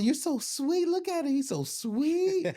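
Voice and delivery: high, cooing mock-tender voice, teasing the little guy; mocking sweet coo. Gym fighters taunting the narrator's brother: high mocking coo. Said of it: "they go".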